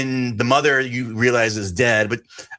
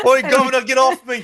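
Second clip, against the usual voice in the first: high, loud hammed-up Cockney shout; squeaky mock Cockney, pitched way up; mock Cockney accent; 14 semitones higher, 4 dB louder than usual. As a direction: play a cartoon London geezer yelling in a Cockney accent. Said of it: "She screams in British".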